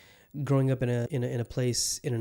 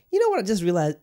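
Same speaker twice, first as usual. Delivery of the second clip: sudden realization; 6 semitones higher, 5 dB louder, faster than usual. Real, not acted. Sudden realization about his birthday.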